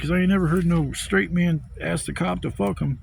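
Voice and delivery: usual speaking voice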